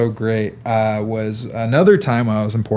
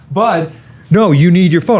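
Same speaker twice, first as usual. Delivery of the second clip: higher, louder insisting; insistent; 5 semitones higher, 7 dB louder than usual. Retold or imitated. Friend in shotgun seat insisting, louder and higher. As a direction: push back hard, louder and higher.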